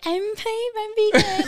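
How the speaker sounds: high, slow mock vegan; pompous mock vegan voice; smug vegan. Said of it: "would be like".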